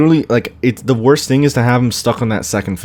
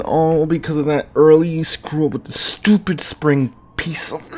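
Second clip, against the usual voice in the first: pitched-up exasperated rant; real annoyance; 6 semitones higher than usual. Real, not acted. Gamer venting at himself over an early mistake, exasperated. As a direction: vent the irritation, voice climbing. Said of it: "I'm so annoyed".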